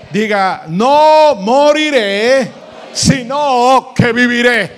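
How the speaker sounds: shouted, slow, high declaration of life; preaching at full force. Acted. Preacher to congregation, repeat after me, full force. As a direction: proclaim it like a victory.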